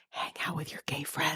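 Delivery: hushed conspiratorial whisper. Real, not acted. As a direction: whisper it like a secret. Conspiratorial aside to co-host.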